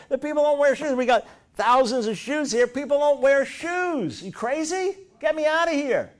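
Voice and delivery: high, loud panicked cry of a stranded salesman; hammed-up frantic plea to be pulled out. Salesman rants at his company.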